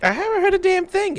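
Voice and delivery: high character voice. Acted.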